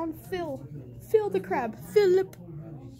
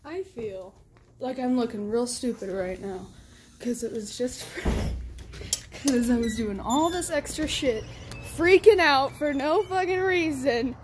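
normal talking voice

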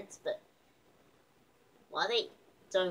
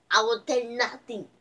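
put-on quoting Bond girl; 4 semitones higher, 7 dB louder than usual. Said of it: "What was the line again?".

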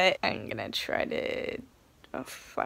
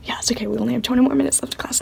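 whispering while hiding; 4 dB louder, much faster than usual. Real, not acted.

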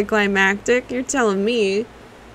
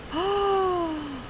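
soft, high drawn-out gasp; quiet airy gasp; 8 semitones higher, 9 dB quieter than usual. Real, not acted. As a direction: breathe in with a long, soft gasp. Sees something in the game.

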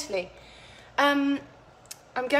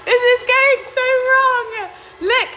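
much higher, louder panicked squeal; high frantic cry over the mess; flustered squeal; 12 semitones higher, 7 dB louder than usual. Real, not acted. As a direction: shriek in comic kitchen panic. Mixture separating, panics to viewers.

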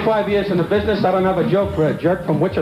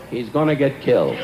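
low, deadpan Jack Benny; a lowered, lightly voiced Jack Benny; Jack Benny impression; 10 semitones lower than usual. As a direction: drop the voice for Benny's warning. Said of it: "Jack Benny, said to me".

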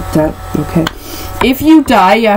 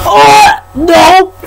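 much higher, louder oh no; shocked shout; 9 semitones higher, 6 dB louder than usual. Real, not acted. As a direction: cry out in shock. Reacts to gross bean.

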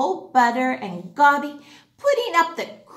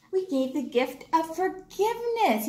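ordinary delivery, nothing marked

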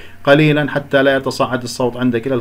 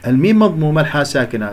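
normal talking voice

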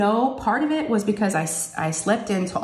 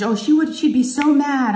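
higher, louder, drawn-out dread; imitating a worried girl; retold worried girl; 7 semitones higher, 4 dB louder than usual. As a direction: play up a kid dreading mom's anger. Girl's worried reply retold.

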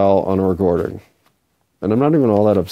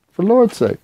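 "For Lord's sake" jumps much higher, stress on "Lord's", falling; exasperated exclaim; 11 semitones higher than usual. Real, not acted. Repeats the whispered oath aloud.

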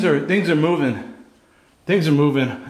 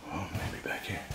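much softer whispered guess; hushed whisper; 4 semitones lower, 16 dB quieter than usual. Real, not acted. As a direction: whisper while creeping forward. Searching quietly.

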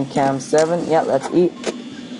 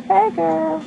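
very high, squeaky put-on voice; high-pitched mock greeting; imitated game character; 14 semitones higher than usual. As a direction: mimic a character in a silly falsetto. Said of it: "He's like".

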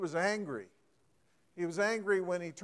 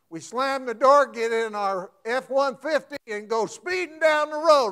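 louder and higher as if storming off; heated, building up; 6 semitones higher, 8 dB louder than usual. Acted. Preacher describing angry drivers, rising heated delivery. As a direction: pile on the anger, getting louder.

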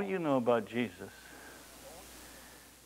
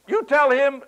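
mother's retort; 13 semitones higher, 9 dB louder than usual. Retold or imitated.

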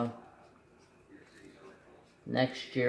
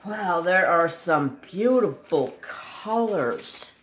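awed; 5 semitones higher, 6 dB louder, slower than usual. Real, not acted. Admiring colors.

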